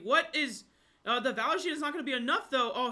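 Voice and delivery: fast, loud hype call; excited. Real, not acted. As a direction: call it like the fight's on the line. Hype over a close fight.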